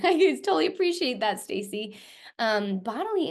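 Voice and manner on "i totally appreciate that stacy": bright laughing reply, higher and louder. Real, not acted. Laughing back at a soapboxy chat comment, higher and louder. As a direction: laugh through it with real delight.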